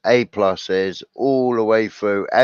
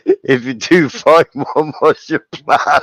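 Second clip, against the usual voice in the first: mock challenge; 6 semitones higher, 6 dB louder than usual. Mocking advice to paranoid people.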